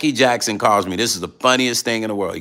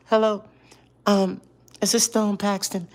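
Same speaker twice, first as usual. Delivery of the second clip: [name]; 11 semitones higher than usual. Retold or imitated. Calling Stone a second time, high gentle imitation. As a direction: imitate a high Michael-like caller. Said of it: "this is just how he sounded".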